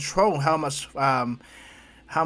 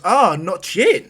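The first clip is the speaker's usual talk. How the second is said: teasing realization; 5 semitones higher, 10 dB louder, much slower than usual. Acted. Izuku teases All Might.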